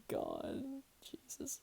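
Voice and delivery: whispered "Jesus" under her breath; disbelieving whisper. Real, not acted. Reacting to the fic, a whispered exasperated "Jesus".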